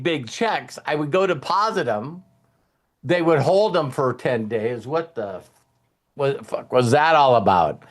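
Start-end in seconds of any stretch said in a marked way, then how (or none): none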